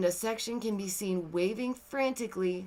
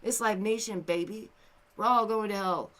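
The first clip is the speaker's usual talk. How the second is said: usual speaking voice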